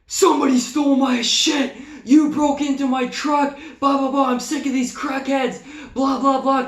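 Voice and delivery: high, loud reenacted rant; retold yelling. Victim yelling at suspected truck thief, angry retold outburst. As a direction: yell it like you've lost it. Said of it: "Immediately start yelling at this dude".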